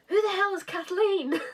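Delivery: high, shocked mock outrage; indignant question. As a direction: squeal it in disbelief. Character demands indignantly.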